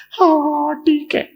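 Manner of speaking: put-on high, little boy's yes; child agreeing. Boy giving in to his mother, high child voice.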